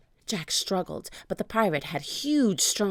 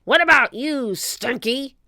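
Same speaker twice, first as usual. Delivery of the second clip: loud, high pirate roar; bullying captain's holler; Captain Bones; 6 semitones higher, 7 dB louder than usual. A narrator's reading. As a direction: roar the question up at a crewman. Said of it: "Captain Bones roared".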